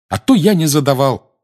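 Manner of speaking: high, loud indignant retort; indignant exclamation. A narrator's reading. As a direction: exclaim it like a wronged boy. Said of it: "негодующе воскликнул Гарри". (негодующе)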